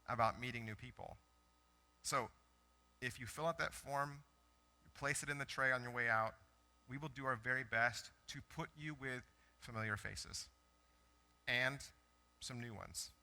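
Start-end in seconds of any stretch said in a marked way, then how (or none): none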